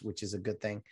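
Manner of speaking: ordinary talk, nothing marked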